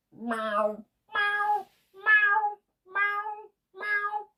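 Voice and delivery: high mewing cat noises; playful high kitty meows; meowing like a cat. Pretend. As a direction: meow like a little kitten. Playing a cat.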